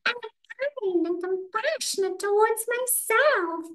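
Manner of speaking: very high, drawn-out cartoon mouse squeak; Mickey Mouse. Shown as a demonstration. Coach playfully repeats an affirmation as a squeaky cartoon mouse. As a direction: put on a squeaky falsetto cartoon voice. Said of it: "Mickey Mouse".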